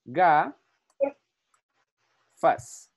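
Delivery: "ga... fas" split by a long pause; syllable by syllable. Shown as a demonstration. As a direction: break the word into "ga-" and "fas".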